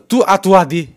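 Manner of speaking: scolding. A narrator's reading. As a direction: shoo him inside sharply. Wife shoos old man inside.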